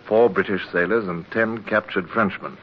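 low, measured narration over music; story narration. A narrator's reading. Older Hornblower recalling his capture, in a reflective narrator voice. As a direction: tell it like a memoir, looking back.

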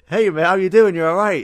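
high, loud, eager hello; excited greeting. Retold or imitated. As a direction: greet him like a starstruck fan. Excitedly greeting Gimli's actor.

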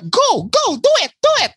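high, rising squeaky urging; mock urging. Friend retelling being told to go record, mock urging. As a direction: imitate someone egging you on, pitch climbing.

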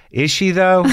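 high-pitched doubting question; mock skeptical. Host teasing his guest about her fame, mock skeptical.

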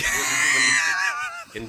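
loud laughing shriek; shouting laugh. Real, not acted. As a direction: lose it mid-question. Laughing searching for a word.

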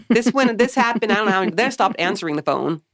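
ordinary talk

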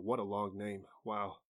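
ordinary delivery

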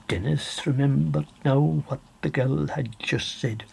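ordinary delivery, nothing marked

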